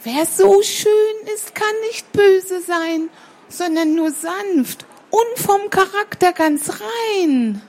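a hint of the little sparrow, pleading; sparrow child.

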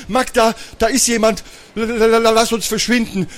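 put-on high, stuttering scared boy; frightened stammer. Brother begs sister to flee the hidden stranger, frightened. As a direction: stammer the first sound in fear.

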